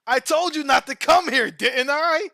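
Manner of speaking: high, loud hammed-up anime kid threat; anime character voice. Retelling the anime kid's line to a friend, loud mock anime threat. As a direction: yell it like a cocky anime hero. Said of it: "he's like an anime character".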